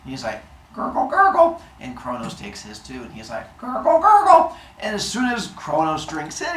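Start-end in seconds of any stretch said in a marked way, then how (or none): 0.8-1.6 high gurgling drinking sound; gulping noise. Acted. Zeus drinks his soda, gurgling noise. 3.6-4.5 high gurgling drinking sound; gulping noise. Acted. Zeus drinks his soda, gurgling noise.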